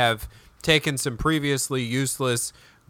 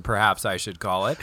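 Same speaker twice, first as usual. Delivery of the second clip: ordinary talk, nothing marked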